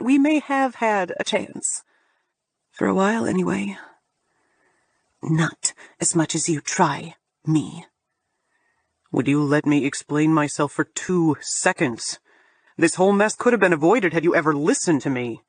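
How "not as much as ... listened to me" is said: lower, frustrated man's voice; exasperated plea in a low voice; low male voice. Acted. Julian, lower voice.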